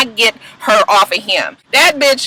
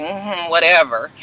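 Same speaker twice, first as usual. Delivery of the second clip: imitating attitude; 7 semitones lower, 5 dB quieter, slower than usual. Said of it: "in her same little attitude".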